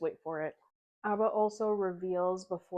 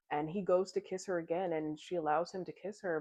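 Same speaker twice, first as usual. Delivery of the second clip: usual speaking voice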